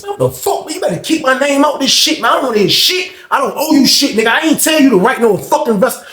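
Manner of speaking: high, heated telling-off; angry rant. Retold or imitated. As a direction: tear into him, furious. Berating the cellmate who snitched.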